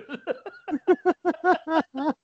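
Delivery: shrill hysterical laugh; high shrieking laugh. Real, not acted.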